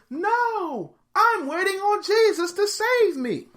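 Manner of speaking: high, loud, slow refusal; shouted refusal. Retold or imitated. Believer shouts up to the chopper, refusing. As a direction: yell it back stubbornly.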